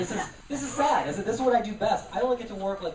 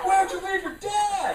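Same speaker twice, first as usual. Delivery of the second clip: high, slow, worried-sounding question; mock concerned heckler; 12 semitones higher, 3 dB louder, slower than usual. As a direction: ask like a fretful social worker. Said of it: "like being heckled by social services".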